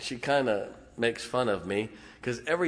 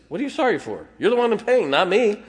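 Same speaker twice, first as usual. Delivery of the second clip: high, loud imitation of a baffled nurse; exasperated retort, acted out; loud incredulous protest; 10 semitones higher, 8 dB louder than usual. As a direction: raise pitch and volume in mock disbelief. Acts out nurses' baffled reply.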